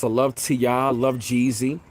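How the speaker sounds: ordinary talk, nothing marked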